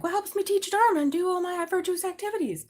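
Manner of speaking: high, put-on mocking monk voice; sing-song mocking of a coffee-drinking monk; mocking nuns and monks. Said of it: "being like".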